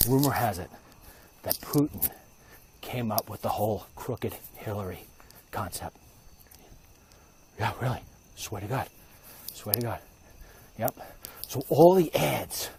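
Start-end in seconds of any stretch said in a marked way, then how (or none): 0.1-10.0 soft, low gossip; conspiratorial hush. Real, not acted. Spreading a rumor to viewers, hushed. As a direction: let them in on it quietly. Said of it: "Don't tell anybody".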